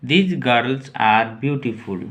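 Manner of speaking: each word slowly and clearly for the class; slow model reading. Shown as a demonstration. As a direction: read it out slowly like a teacher. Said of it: "अब this, यहाँ this था". (धीमे, साफ़ उच्चारण में)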